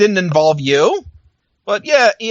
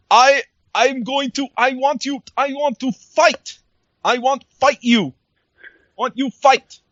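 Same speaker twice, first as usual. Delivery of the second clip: lightly mocking, higher, halting delivery; broken English promo; 6 semitones higher than usual. Mocking a wrestler's clumsy promo, halting, higher. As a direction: stumble through the words like a bad promo. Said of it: "he says it broken English".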